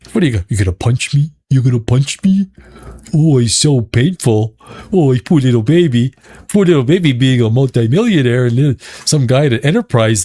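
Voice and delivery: sneering at the actor who got mocked; mocking taunt. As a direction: taunt him like a whiny child. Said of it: "I'm like".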